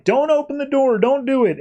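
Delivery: retold cry of warning; imitated yell. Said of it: "Clark's yelling".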